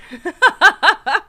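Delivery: high giggling burst; helpless laugh. Real, not acted. Overcome by the news.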